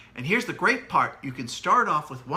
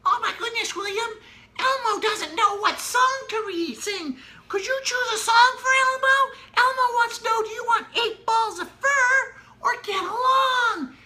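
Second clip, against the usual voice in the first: very high squeaky Elmo, building to a louder call; silly Elmo voice; 5 dB louder than usual. Shown as a demonstration. Parent asking William as Elmo to pick a song, high and growing louder. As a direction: Elmo pleading with the child, rising to an excited cry. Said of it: "Or I could go to William".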